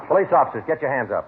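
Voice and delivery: loud, high command to freeze; shouting a police order. Acted. Detective bursting in, shouting at the suspect to raise his hands. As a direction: bark it as you burst in.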